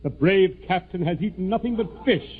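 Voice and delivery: ordinary talk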